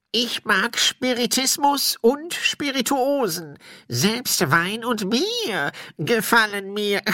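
slow, high spirit voice; high-pitched, drawn-out ghostly declaration; tippling ghost. Acted. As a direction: savour each word as a thirsty ghost.

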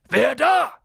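loud, high challenge; shouting. A narrator's reading. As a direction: call out sharply into the dark. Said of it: "rief Hagrid". (laut gerufen)

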